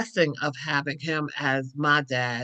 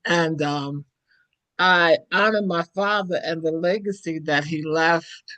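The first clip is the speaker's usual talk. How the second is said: ordinary talk, nothing marked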